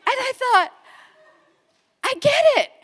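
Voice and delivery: high, loud dawning realization; revelation. Real, not acted. Speaker realizing why she lives there, high dawning wonder. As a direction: let it hit you all at once.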